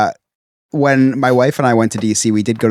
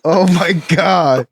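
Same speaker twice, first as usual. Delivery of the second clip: laughing; 8 semitones higher, much slower than usual. Real, not acted. Laughing reaction.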